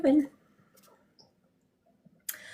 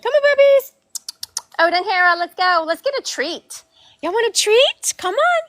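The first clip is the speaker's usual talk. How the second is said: bright sing-song calling, much higher and louder; high-pitched pet call; 10 semitones higher, 5 dB louder than usual. Real, not acted. Owner calling her dogs in for treats, high sing-song coaxing. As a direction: coax the dogs in like calling puppies.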